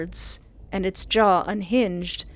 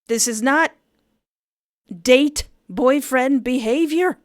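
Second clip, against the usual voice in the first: indignant; 5 semitones higher, 5 dB louder than usual. Acted. Indignant complaint.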